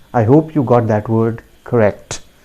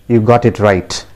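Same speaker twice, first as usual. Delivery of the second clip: ordinary talk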